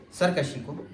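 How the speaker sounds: normal talking voice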